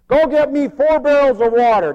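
high, loud prophet's order; commanding shout. Acted. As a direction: call the order out over the crowd. Acts Elijah's order.

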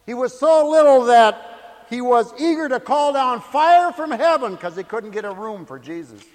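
loud, high preaching voice, easing toward the end; full-force preaching. Real, not acted. Preacher telling of John's littleness, loud impassioned preaching. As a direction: preach it out to the back row.

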